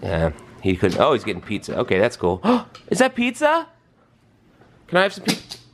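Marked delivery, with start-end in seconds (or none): 2.4-5.6 mock surprise. Playing along with child's pizza.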